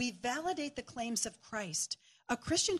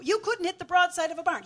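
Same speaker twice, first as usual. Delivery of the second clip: higher, louder mock yell at the screen; sports fan heckling the TV, higher and louder; 6 semitones higher, 6 dB louder than usual. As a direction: imitate a sports fan barking at the players. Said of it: "he'll yell".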